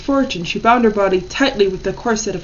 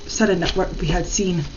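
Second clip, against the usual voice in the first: ordinary delivery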